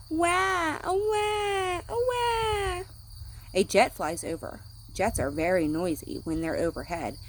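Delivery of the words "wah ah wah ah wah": high wailing wah; mock baby crying. A narrator's reading. Reading to children, high pretend baby wail. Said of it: "A baby crying".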